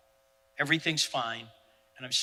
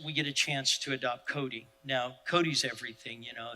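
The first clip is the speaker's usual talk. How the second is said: ordinary talk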